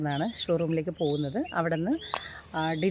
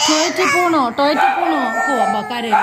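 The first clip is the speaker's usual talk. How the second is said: higher and louder mother's sing-song; 6 semitones higher, 8 dB louder than usual. Real, not acted. Mother coaxing crying toddler.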